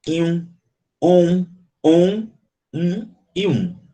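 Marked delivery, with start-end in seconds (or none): 1.0-2.3 drawn-out nasal on; nasal on sound. Shown as a demonstration. Teacher demonstrating a nasal vowel, drawn out. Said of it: "você nasalisa ele".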